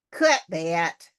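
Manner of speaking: loud, curt story-character order; sharply. A narrator's reading. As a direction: give a sharp, forceful command. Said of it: "said Travis sharply".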